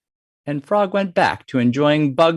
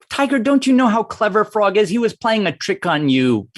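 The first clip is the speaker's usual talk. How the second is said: higher-pitched fox voice, animated; chiding Fox; 6 semitones higher than usual. Acted. Fox tells Tiger he was tricked, high and incredulous. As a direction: scold a gullible friend in a high voice.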